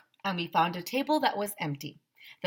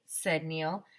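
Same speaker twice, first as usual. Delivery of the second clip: usual speaking voice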